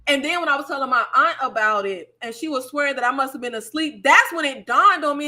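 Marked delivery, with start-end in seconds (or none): none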